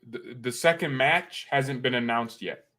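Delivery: ordinary talk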